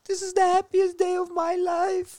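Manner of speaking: high, loud, tearful mock cry of joy; crying teacher's joy. Teacher squeals through tears to class about Fidel. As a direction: squeal it out like she's sobbing with happiness. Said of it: "She was like".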